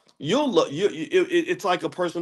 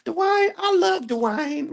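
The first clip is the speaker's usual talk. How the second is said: high, slow Southern belle swoon; "Dwayne" stretched out high and slow in a Southern belle accent; Whitley Gilbert accent; 4 dB louder, much slower than usual. Retold or imitated. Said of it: "Can you do an imitation of Whitley?".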